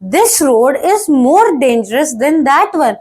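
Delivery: each word slowly and clearly, like dictation; slow dictation of the example sentence. Shown as a demonstration. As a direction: spell it out for the class. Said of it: "आप क्या कहोगे".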